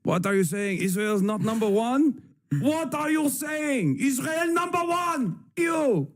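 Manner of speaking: hammed-up Israeli accent, rising high and slow; exaggerated Israeli accent climbing to an indignant shout; mock Israeli accent. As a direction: play an outraged fan in a put-on Israeli accent. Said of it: "pretty good Israeli accent".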